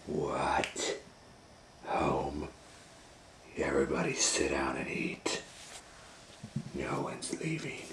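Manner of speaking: low, whispered menace; quiet sinister gorilla; menacing whisper. Acted. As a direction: hiss it threateningly.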